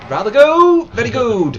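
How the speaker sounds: high, loud comic voice; hammed-up loud approval; silly mock exclamation. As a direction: whoop it out playfully. Silly voice mocking own slip.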